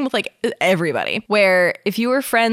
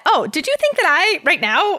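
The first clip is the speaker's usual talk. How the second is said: feigned innocence; 10 semitones higher, 3 dB louder than usual. Acted. Daichi teasing innocently.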